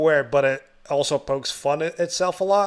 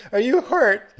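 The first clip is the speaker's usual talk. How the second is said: higher, louder, incredulous mimicry; mock the dumb question, pitched up; 9 semitones higher, 5 dB louder, slower than usual. Retelling the idiot asking a wounded girl, high mocking.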